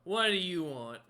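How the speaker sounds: loud, gruff giant snorting a demand; a frost giant sizing up a beggar, loud and gruff. Acted. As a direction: bark it down at the small stranger. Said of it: "snorts in your face and goes".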